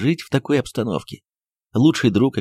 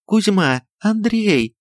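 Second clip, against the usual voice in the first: calling for help; 10 semitones higher, much slower than usual. Acted. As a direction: summon the servants in fright. Жена зовёт слуг на помощь. (испуганно и громко зовёт слуг)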